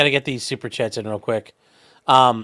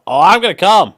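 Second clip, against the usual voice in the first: louder, higher mock climax; loud, higher mock moan; hammed-up moan; 5 semitones higher, 9 dB louder than usual. Shown as a demonstration. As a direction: camp it up as a joke. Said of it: "Well, hold on".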